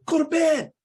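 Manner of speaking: high, stern dad command; mock strict father; dad's order. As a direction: bark the bedtime order at her. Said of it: "So I'll tell my daughter".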